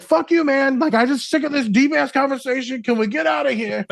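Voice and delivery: high, loud mock complaint with a laugh; fed-up best friend. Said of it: "He's like".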